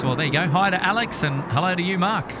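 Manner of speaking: usual speaking voice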